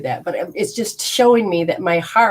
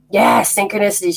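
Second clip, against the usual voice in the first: usual speaking voice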